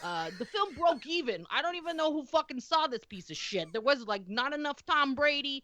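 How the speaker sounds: put-on light Boston accent, swearing as she reads. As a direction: reads the stats as a cranky Boston local. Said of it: "in the voice of a heavy Boston accent".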